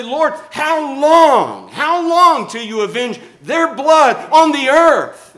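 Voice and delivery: high, loud, drawn-out cry to heaven; righteously indignant. Acted. Preacher pleading with God for vengeance, righteously indignant. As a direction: stretch the words out in an indignant shout. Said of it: "I get righteously indignant and I say".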